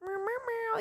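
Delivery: high, soft, sing-song cooing to a stray cat; tiny high kitty-call, meowing softly. Shown as a demonstration. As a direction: coax the kitty out of the bushes with a high little meow. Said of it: "my cat voice or whatever".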